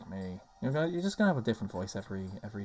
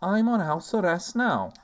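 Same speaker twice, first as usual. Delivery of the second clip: higher, emphatic character voice; defensive Garaha; 7 semitones higher, 5 dB louder than usual. Acted. Garaha explaining house arrest to Meru, defensive. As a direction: protest it with punched words.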